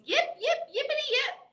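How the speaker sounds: high, yappy puppy yips. Acted. Reader voicing Zippy the puppy, high and yappy. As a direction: yip like an excited little dog.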